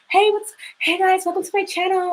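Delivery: very high-pitched parody vlogger greeting; mock YouTuber voice. YouTuber greeting viewers of her channel, mock high voice. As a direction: imitate a weird, put-on YouTuber intro. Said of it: "the YouTuber voice is freaking weird".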